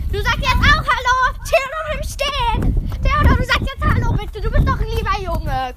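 high sing-song coaxing. Real, not acted. Coaxing little brother, high voice.

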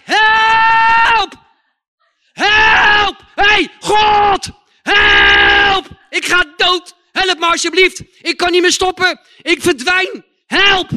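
loud, high-pitched desperate cries to God; schreeuwend om hulp. Retold or imitated. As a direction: cry out for rescue with long, full-force shouts. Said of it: "ik schreeuwde het uit". (wanhopig om hulp schreeuwen)

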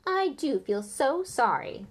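slow, mock-sorry drawl. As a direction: sneer with fake pity. Malfoy taunting Harry.

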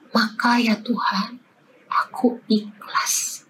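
unvoiced whisper of surrender, slow; whispered prayer. Mother shows daughter how to pray, whispered slowly. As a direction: breathe out the prayer softly, barely voiced. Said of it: "Bilang, 'Tapi ya Tuhan".

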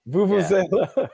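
Vuvuzela said high through a laugh; laughing, high pronunciation. Retold or imitated. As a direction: laugh while saying Vuvuzela. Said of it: "You know how to say it".

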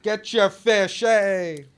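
high, drawn-out vendor's call; cartoonish market seller crying wares; fish market hawker. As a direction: hawk the fish in a high-pitched singsong. Acted fish market vendor calling out.

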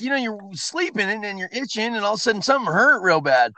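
ordinary delivery

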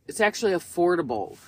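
normal talking voice

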